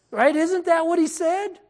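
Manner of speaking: normal talking voice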